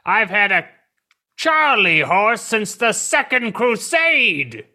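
hammy Ivan Ooze. Retold or imitated. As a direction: draw out the line like a hammy old villain. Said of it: "And what does he say? He says".

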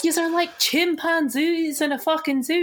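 high-pitched retold tirade; mock angry rant. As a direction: rant it in imitation of a furious housemate. Acting out Jason's angry outburst.

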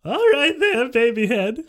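high, loud teasing reply; very high, loud character voice; grinning Johann. Acted. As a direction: tease through a grin. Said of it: "grinned Johann".

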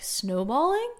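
"Snowballing?" repeated back with a questioning rise at the end; incredulous question. Retold or imitated. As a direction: echo her word back, mildly puzzled. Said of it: "he capitalized on the one extra thing".

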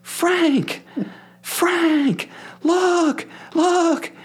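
very high, thrilled lady's voice; excited woman fan. Retold or imitated. Woman on the plane urging her husband to look, high excited squeal. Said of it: "I hear this woman go".

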